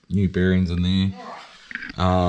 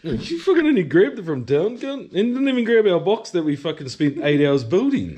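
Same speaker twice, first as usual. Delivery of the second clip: mock angry; 10 semitones higher, 4 dB louder than usual. Mock angry banter at mate.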